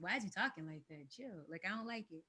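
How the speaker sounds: soft, unimpressed put-down; mild callout. Retold or imitated. Calling out someone faking how they talk, softly unimpressed. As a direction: brush them off like it's cringe.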